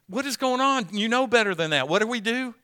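put-on fussing at the child who made a mess; scolding parent.

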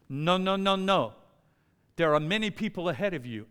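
put-on French accent; hammy French-accented refusal; French clerk refusing. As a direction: brush him off in mock French. Imitating French clerk refusing him.

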